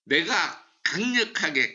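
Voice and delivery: ordinary talk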